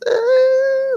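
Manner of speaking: very high, held "ehhh" squeal; mock outtake-reel noise; imitated silly noise. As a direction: stretch out a high, silly drone. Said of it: "you just making noises".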